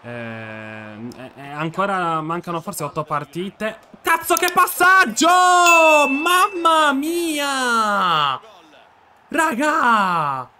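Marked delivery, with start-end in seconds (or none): 4.0-10.5 high, loud yell of joy at a pass; excited shout. Real, not acted. Gamer yelling at a perfect through ball, excited shout. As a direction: shout it out, thrilled.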